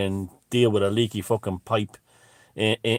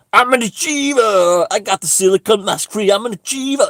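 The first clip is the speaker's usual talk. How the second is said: a mock victory chant, much higher and louder; triumphant crowing; 9 semitones higher, 6 dB louder than usual. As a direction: crow it like you just won gold. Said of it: "this kind of fucking, just, like, laughing to myself".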